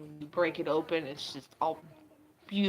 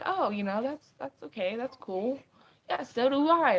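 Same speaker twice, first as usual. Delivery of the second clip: higher, airy approving tone; mild mock approving voice; 7 semitones higher than usual. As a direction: lightly voice a relaxed friend. Quoting accepting people now.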